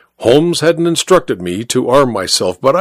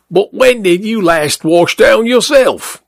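higher, jeering cabman's voice; mocking rival cabby; 8 semitones higher than usual. Second cabby jeers at the first driver, higher mocking voice. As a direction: needle the other driver with a higher sneer.

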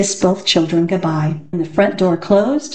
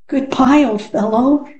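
called out sadly; 6 semitones higher than usual. Acted. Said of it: "he called out sadly".

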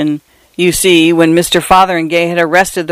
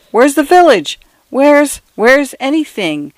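bewildered; 8 semitones higher than usual. Acted. Dazed man lost in storm.

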